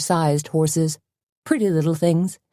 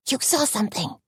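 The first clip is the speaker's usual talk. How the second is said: soft, tense hiss; 8 semitones higher, 5 dB quieter than usual. A narrator's reading. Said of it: "Tony hissed".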